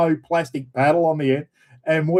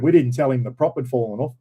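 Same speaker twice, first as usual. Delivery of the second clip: ordinary talk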